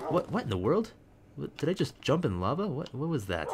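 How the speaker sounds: high, sudden startled questions; a jolt of real surprise. Real, not acted. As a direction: caught off guard by lava. Sudden lava surprise in game.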